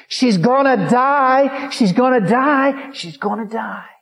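high, slow eerie spirit voice. Retold or imitated. A spirit whispering doom to the lady, high and slow. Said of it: "A spirit spoke to her and says".

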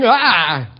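short loud "aah" of fright; mock frightened scream. Preacher acts out seeing a scary thing, mock scream. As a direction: cry out as if spotting danger.

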